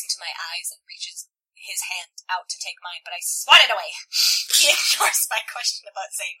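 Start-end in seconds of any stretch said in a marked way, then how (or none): none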